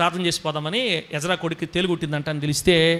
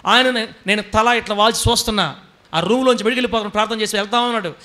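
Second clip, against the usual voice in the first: ordinary talk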